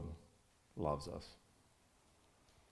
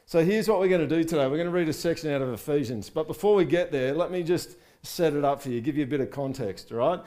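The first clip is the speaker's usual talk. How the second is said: louder, rising fervent preaching; preaching at full force; 4 semitones higher, 6 dB louder than usual. Real, not acted. Pastor rallies his congregation, full fervent preaching. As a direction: lift the room, preach it out.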